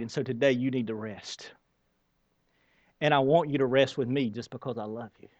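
God reassures the weary worker, in a soft tender voice; gentle voice of God. Retold or imitated. Low soft reassuring voice. Voicing God's gentle answer.

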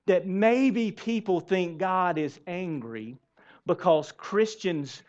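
ordinary talk, nothing marked

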